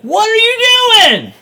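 high, loud mock outcry; retold alarmed yell. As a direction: imitate a client yelling in alarm. Imitates alarmed tattoo client.

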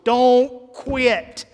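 don't quit called out loud and high; rally them, don't quit; loud preaching. Real, not acted. Pastor urging the church on.

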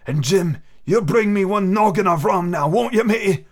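put-on a sick old sailor coaxing the boy; old pirate captain. Narrator voicing the captain.

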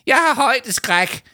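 tiny frightened peep; squeaking. A narrator's reading. Adviser dangling in the air, frightened squeak. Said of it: "pep Leo Manchot".

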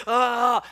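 high, loud mock-panic "ah"; frantic cry. Preacher showing what seeking God is not, frantic cry. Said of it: "it's not this frantic".

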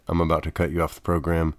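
ordinary talk, nothing marked

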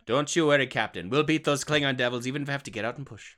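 loud, fast Scottish engineer; rally the captain in brogue; hammed-up Scotty reassurance; Scotty impression. Scotty quote continues.

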